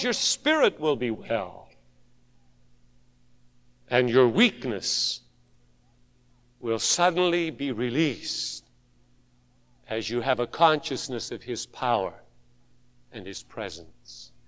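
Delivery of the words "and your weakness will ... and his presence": lower, much softer and fading; preacher easing into quiet assurance; soft preaching. Acted. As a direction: bring it down to a hush.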